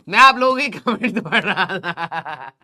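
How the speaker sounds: said through a laugh. Real, not acted. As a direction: chuckle while repeating the line. Laughs while reading comments. (हँसते हुए)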